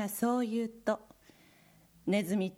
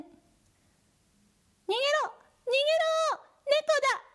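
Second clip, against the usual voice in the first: very high, loud squeals of panic; panicked shout; 4 dB louder, much faster than usual. Acted. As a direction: scream it out in terror. Mice flee in panic.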